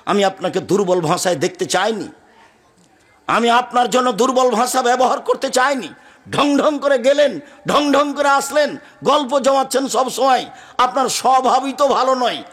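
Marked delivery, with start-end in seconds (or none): 3.3-12.4 high-pitched, declaimed reproach; high, insistent sermon delivery; impassioned preaching. Acted. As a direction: preach the complaint in a raised pitch. Acted complaint in sermon.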